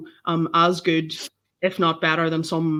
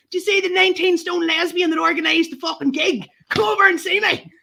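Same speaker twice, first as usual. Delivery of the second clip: high-pitched mock scream at two people; retold scream; 11 semitones higher, 3 dB louder than usual. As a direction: re-enact screaming orders, voice shooting right up. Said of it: "I actually screamed at these".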